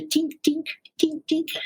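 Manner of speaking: high, light imitated clink of metal on rock; ping out each tink like an axe on stone; tink sound. Ice axe hitting rock.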